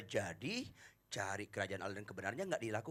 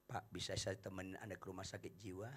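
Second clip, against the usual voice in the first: soft, soothing and softer; softer salesman; 4 semitones lower, 8 dB quieter than usual. Acted. Salesman gently offering to take the customer to the asylum, soft. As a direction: speak gently to a madman. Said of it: "Makin lembut suaranya".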